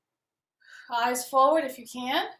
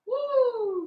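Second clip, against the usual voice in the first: playful woo; 10 semitones higher than usual. Real, not acted. Balance class, playful exclamation.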